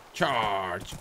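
hammed-up high, loud cowboy yell; playful battle cry. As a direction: spur the horse on like a cartoon rider. Riding horse into battle.

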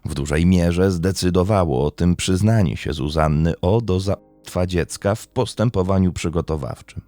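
plain reading voice

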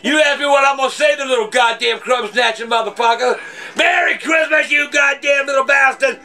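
shouting cuss-filled threats; furious Santa. Acted. Santa Claus refusing the kid any presents, furious shouting. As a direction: rage at the little brat.

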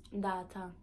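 "Data" with island t and open final a; Virgin Islands accent. Shown as a demonstration. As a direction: say "Data" the Saint Thomas way. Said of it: "my accent".

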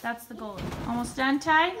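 ordinary delivery

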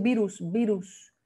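virus with a Spanish "oo" in the last syllable; learners' pronunciation. Retold or imitated. Teacher imitating learners saying "virus", Spanish pronunciation. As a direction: say "virus" the Spanish way, "BEE-roos". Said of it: "Ustedes dicen".

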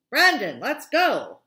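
loud, drawn-out call across the lot; yelling. Acted. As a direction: yell for him to come now. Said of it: "Mister Lester yelled".

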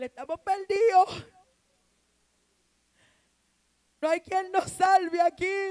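high, loud lament; mock despair. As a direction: cry out as if all is lost. Voicing John's despair. (lamento fingido de desesperación)

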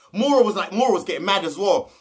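ordinary talk, nothing marked

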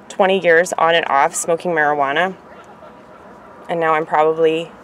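ordinary talk

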